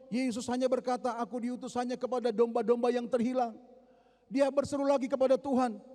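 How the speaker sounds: high, loud, driving sermon retelling; fiery preaching. Acted. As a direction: preach the story with full force.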